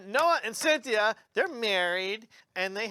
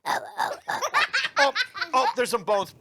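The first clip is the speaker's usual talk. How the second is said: laughing dinosaur; 4 semitones higher, slower than usual. Acted. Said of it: "And he's like".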